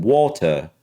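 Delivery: British "water" with crisp T, no final R; British accent. Retold or imitated. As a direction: imitate a British person saying "water". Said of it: "when a British person says it".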